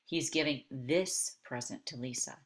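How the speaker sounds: THIS punched and set apart before the rest; stress on THIS. Shown as a demonstration. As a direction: hit the word THIS, then pause. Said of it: "by emphasizing this".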